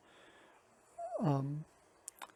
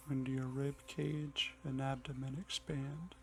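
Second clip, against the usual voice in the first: soft, unhurried meditation voice with long pauses; hushed and calm, easing listeners into the breath; guided meditation; 9 dB quieter than usual. Acted.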